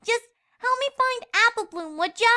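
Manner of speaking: put-on high, light young pony girl voice; a playful filly teasing her friend. A narrator's reading. Scootaloo teasing Sweetie Belle, high filly voice.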